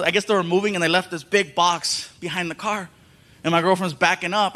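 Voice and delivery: usual speaking voice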